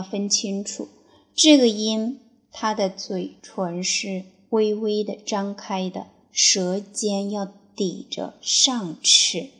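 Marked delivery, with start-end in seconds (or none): none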